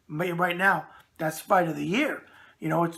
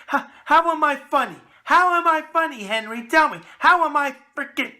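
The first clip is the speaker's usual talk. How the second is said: mock indignant; 8 semitones higher, 7 dB louder than usual. Real, not acted. Host teased by laughing crew.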